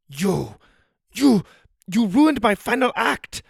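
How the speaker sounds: high, furious rising yell; angry shout. Acted. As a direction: explode at him in rage. Jackie Chan angry outburst.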